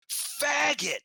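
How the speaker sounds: high, loud retold outburst; sudden high-pitched shouted imitation; really loud yell. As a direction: yell it out like the shocked uncle. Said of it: "Really loud".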